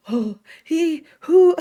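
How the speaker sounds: mock stunned reaction; shocked. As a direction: squeal the who in shock. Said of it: "I was just like".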